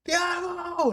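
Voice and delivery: very high, drawn-out name call; reenacted yelling. Fan calling to a player running off, high reenacted yell. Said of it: "And I'm yelling".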